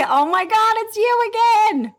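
high-pitched, gushing mock delight; excited greeting. Client picking up to a favourite caller, in excited high delight. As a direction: act thrilled to hear who's calling.

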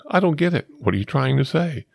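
high-pitched quote of a baffled friend; Wes. Retold or imitated. Repeating his friend's favorite saying, mock bewilderment. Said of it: "What a great line".

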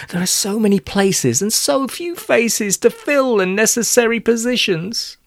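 a hint of a mournful teacher; sad teacher. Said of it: "looking sad".

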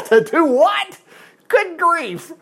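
high-pitched, drawn-out comic disbelief; incredulous. Acted. Preacher hearing of Saul's monument to himself, mock incredulous. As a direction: react aghast, laughing through it.

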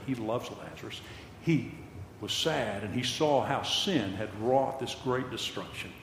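usual speaking voice

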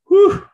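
high loud whew of relief; relieved whew. Real, not acted. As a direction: blow out a happy whew. Relief about fantasy pickup.

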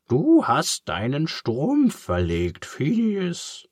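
high, slow, knowing pig voice; high put-on pig voice, patient sigh; clever pig Charles. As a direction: voice a clever, slightly weary pig.

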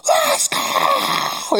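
a very high, loud scream. Retold or imitated. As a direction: scream it like a coach at a double play. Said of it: "I lost my voice screaming".

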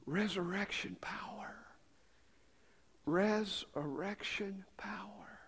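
very soft, breath-only whisper; awed whisper. Real, not acted. Preacher marvels at resurrection power, whispering.

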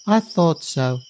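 put-on a boy fearing the answer. A narrator's reading. Boy to the prince, lightly voiced anxious child.

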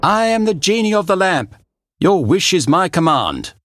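grand magical proclamation; genie voice. Acted. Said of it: "the magic genie whooshed out".

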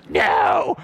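sudden high, loud no; joking shout. Real, not acted. Pastor jokingly denying his dad was insecure, shouting. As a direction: yelp it out as a joke.

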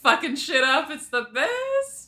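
high, gleeful mock cheer; mock hype. Book club friend mimicking fans cheering a fight in a high voice. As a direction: cheer like a fan watching a brawl.

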